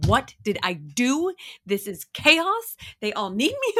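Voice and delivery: high, loud, slow mock panic; overwhelmed exclaiming. Mother of ten wailing that all the kids need her. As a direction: throw up your hands in mock despair, loud and high. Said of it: "other days I'm thinking".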